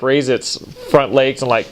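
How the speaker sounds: normal talking voice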